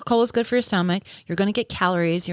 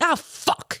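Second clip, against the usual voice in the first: mock alarm; 10 semitones higher than usual. Hears girls are coming.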